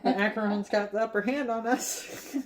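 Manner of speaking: half-laughing prophecy of doom; mock naysayer. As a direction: imitate a panicky pessimist, amused. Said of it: "He's a huge naysayer".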